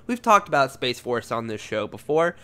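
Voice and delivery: normal talking voice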